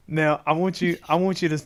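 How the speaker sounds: ordinary delivery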